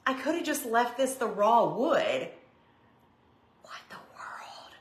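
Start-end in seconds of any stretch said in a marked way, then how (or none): 3.6-4.7 breathy whisper to herself, baffled; whispered aside. Real, not acted. Host realises she needn't have painted, baffled whisper. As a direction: whisper it under your breath in disbelief. Surprised at her own painting.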